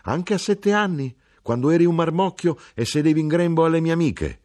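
high, loud motherly outburst; esclamazione. A narrator's reading. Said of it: "esclamò mia madre". (sfogo materno acuto, in crescendo)

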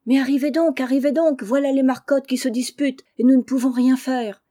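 high, loud rushed alarm; urgent call. Acted. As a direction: hurry them over, calling out. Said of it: "Laurent, accourant". (appel pressé et alarmé)

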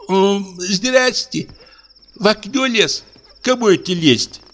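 high, slow sleepy indignation; a cook woken up, pitched high and offended; indignant cook. Acted. Cook wakes, denies.

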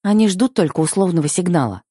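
usual speaking voice

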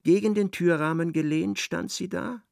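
ordinary delivery

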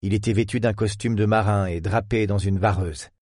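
plain reading voice

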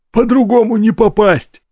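high, strained rasp; choked wheeze. A narrator's reading. Rorik choked against the wall, high wheeze. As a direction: wheeze it through a squeezed throat. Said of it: "прохрипел тот".

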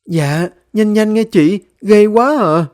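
high, loud drawn-out plea; frightened urging. Acted. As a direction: urge them to hurry, spooked. Frightened girl urging her neighbour.

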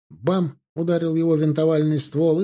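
plain reading voice

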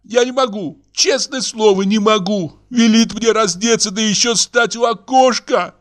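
very high, distraught woman's protest; high-pitched plaintive outcry; tearful wailing. Acted. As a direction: wail in outrage and despair. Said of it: "зарыдала Каролина".

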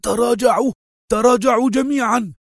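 high-pitched cry to retreat; panicked yell. A narrator's reading. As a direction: cry out high to the whole troop. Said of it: "صرخ وهو يهرول".

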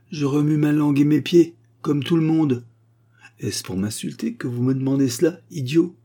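insulted by the question; offended mummy. Acted.

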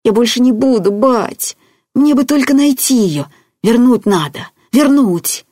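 high, loud, desperate whine; childish, worked-up pleading; babbling plea. A narrator's reading. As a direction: beg your father insistently. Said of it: "бубнил Петька".

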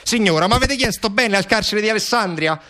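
high, put-on telephone voice; harried phone official. Prison clerks send parents elsewhere, harried brush-off.

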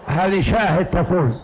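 ordinary talk, nothing marked

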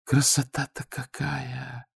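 soft, slow, marvelling; awed. A narrator's reading. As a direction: whisper of pure wonder. Manyushka admires the ring.

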